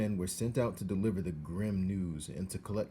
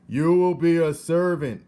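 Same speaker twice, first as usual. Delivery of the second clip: loud, high shout; loud raised shout; 9 semitones higher, 14 dB louder than usual. A narrator's reading. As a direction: bellow it to a deaf girl. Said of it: "shouted the soldier".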